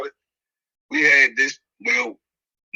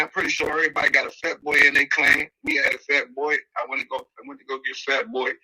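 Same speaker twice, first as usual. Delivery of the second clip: ordinary delivery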